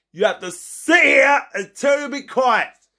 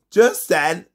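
ordinary delivery

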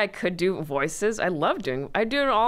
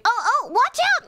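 very high, louder panicky cartoon bird; 5 dB louder than usual. Shown as a demonstration. Voice actor demos her cartoon bird role, very high and frantic. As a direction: shriek a warning as the frantic little bird. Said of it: "the bird I play, her voice is like".

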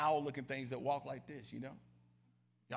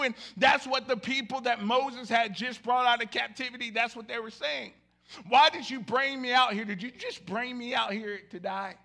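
louder, driving sermon build; full-force preaching; 5 semitones higher, 10 dB louder than usual. Acted. Pastor stirring up the congregation, loud preaching. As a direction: preach it hard to the room.

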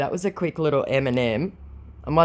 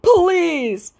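high, loud hammed-up plea; theatrical begging cry; mock pleading; 6 dB louder than usual. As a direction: squeal it like a desperate beggar. Voices scammer's plea.

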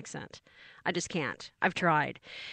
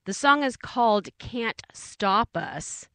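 usual speaking voice

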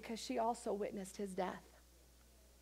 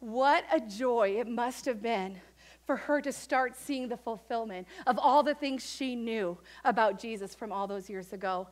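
ordinary talk

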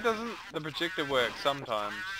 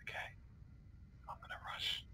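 hushed unvoiced whisper; much softer whisper, sneaking; 19 dB quieter, faster than usual. Real, not acted. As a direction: whisper so nobody hears.

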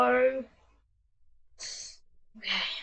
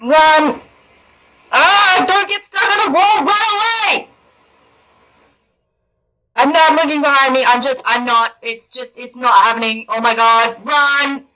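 loud panicked urging to run; being chased; 4 dB louder than usual. Real, not acted. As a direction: flee in panic, yelling. Chased through the maze.

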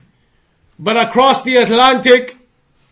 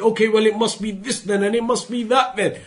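much softer, offhand aside; imitated guesswork; 8 dB quieter than usual. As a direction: toss it off softly as someone else's theorizing. Voicing the philosophers' guesswork.

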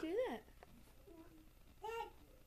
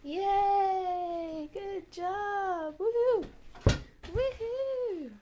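cheering; 4 semitones higher, 7 dB louder, much slower than usual. Real, not acted. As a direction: cheer the little one on. Cheering child on.

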